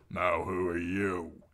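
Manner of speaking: slow, lightly voiced heavy soldier; deep-voiced demand. A narrator's reading. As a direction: demand it slowly in a hint of a deep voice. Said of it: "in the deep voice that Arya had heard".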